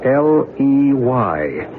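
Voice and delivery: each letter spelled out slowly and clearly; spelling the word out slowly for a listener. Acted. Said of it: "How do you spell ley?".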